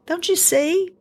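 high, pleading exasperation; frustrated mother pressing her son to notice; upset mom. Retold or imitated. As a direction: throw it up high in disbelief. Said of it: "I would get upset with him".

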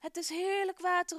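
high, loud happy cry; delighted exclamation. Acted. Gerson tastes the sweetened water and cries out delighted. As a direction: exclaim it in joyful surprise. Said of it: "roept hij uit".

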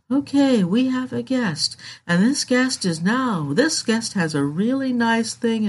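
mock a sleepy public radio interviewer; hammed-up dull NPR host; NPR host impression. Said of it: "that's my N P R impression".

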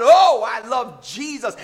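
Oh called out loud, the rest driven hard; emphatic declaration. Shown as a demonstration. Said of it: "we declare it with emphasis".

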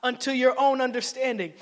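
slow, ringing proclamation; proclaimed scripture. Shown as a demonstration. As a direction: declare the verse with force. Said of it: "No, it says".

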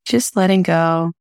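calm, even meditation voice with long pauses; unhurried guiding, leaving space between phrases; guided meditation. Acted. Host guiding listeners through breathing, calm meditation voice.

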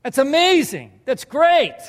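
a proud teacher beaming; Jesus cheering Peter on; delighted praise. Acted.

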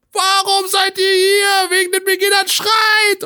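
high, slow, shouted crowd call; stage scream. Retold or imitated. Rapper screaming at the crowd to cheer for the band. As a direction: scream it across a full hall.

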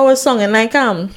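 normal talking voice

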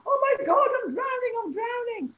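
high, loud retold scream of panic; panicked scream. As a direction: scream it like she thinks she's drowning. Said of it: "She's screaming".

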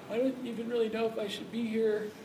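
quiet, timid, hesitant inner voice; unsure and timid. Retold or imitated. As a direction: sound like you don't belong there. Unsure student at school.

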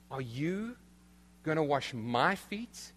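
YOU and MY drawn out, questioning rise; stress on YOU and MY. Acted. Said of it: "that literally is the emphasis".

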